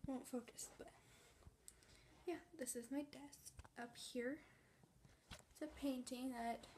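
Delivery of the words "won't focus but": defeated whisper. Real, not acted. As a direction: give up in a whisper. Gives up on camera.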